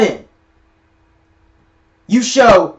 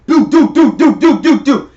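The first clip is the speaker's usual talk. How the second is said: sung missile blasts do do do; vocal missile noises; missile sound effect; 5 semitones higher, 4 dB louder than usual. Retold or imitated. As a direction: mimic the rockets firing in bursts.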